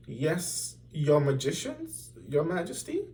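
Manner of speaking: high, frightened servant voice; a servant trembling before his king; shivering servant. A narrator's reading. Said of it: "Bartholomew shivered".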